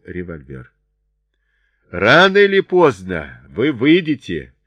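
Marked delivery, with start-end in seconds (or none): 1.9-4.5 loud, high taunting call; shouting down. A narrator's reading. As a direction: shout it down to people in hiding. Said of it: "кричал им Чарли вниз".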